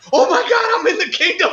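thrilled. Retold or imitated. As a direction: burst out with delight at the script. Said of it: "and I'm like".

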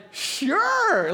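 high, breezy sure; casual Paul. Acted. Paul agrees to teach them all, high and breezy.

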